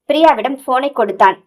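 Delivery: plain reading voice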